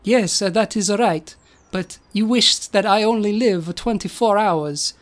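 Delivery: mock-Italian robot voice; measured cartoon Italian. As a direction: answer in a stagey Italian accent. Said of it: "stereotypical Italian accents".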